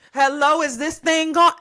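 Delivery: playful loud announcement; mock mic check. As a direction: test the mic with bold swagger.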